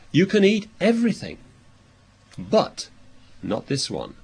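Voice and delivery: God's emphasis: all yes, then one firm not; stress on everything and not. Shown as a demonstration. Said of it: "So the emphasis of God was".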